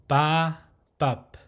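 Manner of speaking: "ba" held open, "bop" shut on the lips; open then closed. Shown as a demonstration. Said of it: "how you pronounce the closed phonetic".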